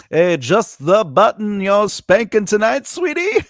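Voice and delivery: high, loud, teasing come-on; silly character voice. Pretend. Voice actor teasing the host about spanking buttons, silly high voice. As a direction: tease it slowly, climbing up high on sweetie. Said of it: "Jammers with the voices".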